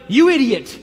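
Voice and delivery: retold manager yelling at his center fielder; furious shout. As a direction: bark it out like an enraged manager. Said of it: "and shouted".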